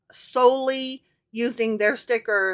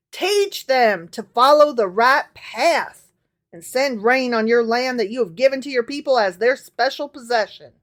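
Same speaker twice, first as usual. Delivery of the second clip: scripture reading; 5 dB louder than usual. Acted. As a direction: read it like a sermon from the pulpit. Reads scripture aloud.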